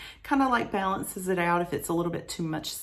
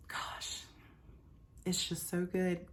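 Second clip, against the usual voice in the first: breathy whisper; 13 dB quieter, slower than usual. Real, not acted. Sniffing her favorite bar.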